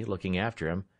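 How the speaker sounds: plain reading voice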